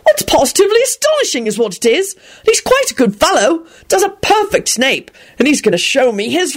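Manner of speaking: high-pitched, enthusiastic gentleman; gushing. A narrator's reading. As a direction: gush with astonished admiration. Arthur gushes about his new friend.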